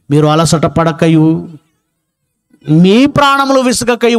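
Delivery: measured, drawn-out verse reading; scripture reading. Acted. Preacher reads the chapter aloud to the congregation, measured scripture reading. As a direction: read it out phrase by phrase with long pauses.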